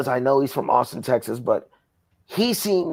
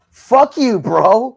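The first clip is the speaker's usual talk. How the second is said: angry outburst; 8 semitones higher, 7 dB louder, much slower than usual. Real, not acted. Angry outburst at rival promoter.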